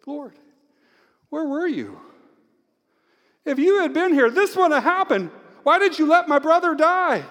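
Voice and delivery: high-pitched anguished plea, building to loud; crying out. Acted. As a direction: cry out in grief to the Lord. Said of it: "she kinda words it like this".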